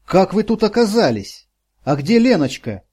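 she's startled, demand to know; a frightened old woman raising her voice; alarmed old woman. A narrator's reading. Startled old woman questions stranger.

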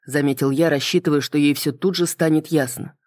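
ordinary delivery

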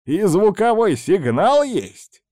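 put-on high, amazed old witch; delighted disbelief. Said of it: "сказала Баба Яга".

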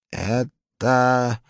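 high, loud, very slow menacing question; loud slow threatening boss. A narrator's reading. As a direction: draw it out to freeze him. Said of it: "таким голосом".

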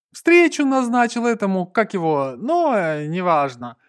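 loud, high start dropping into a brush-off; dismissive. A narrator's reading. Cuban explaining his appointment, loud then dismissive. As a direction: grope for the name, then wave it away. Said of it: "отмахнулся кубинец".

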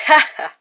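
loud, high acted "ha ha"; triumphant shouted laugh; crowing laugh. A narrator's reading. Headless knight catching out his host, crowing mock laugh. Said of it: "s'écria la tête de Sir Patrick".

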